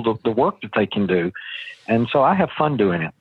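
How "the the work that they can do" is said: normal talking voice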